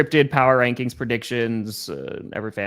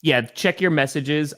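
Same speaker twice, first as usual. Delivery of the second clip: ordinary talk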